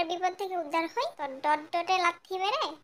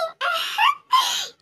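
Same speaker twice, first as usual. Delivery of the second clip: high giggle; 6 dB louder than usual. Acted. [name] laughs at own joke.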